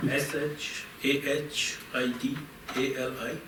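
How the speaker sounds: letter by letter; spelling it out. Real, not acted. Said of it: "I'm gonna ask you to spell it for me".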